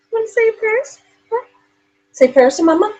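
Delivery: high, hushed sing-song to the dog. Acted. Coaxing dog, softer.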